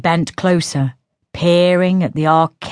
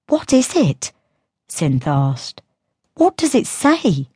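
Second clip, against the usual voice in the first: put-on higher, light teasing girl's voice; girl Synth voice; 3 semitones higher than usual. A narrator's reading. Sister taunting Diello about his tale, in a higher teasing voice. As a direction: taunt him as the younger sister.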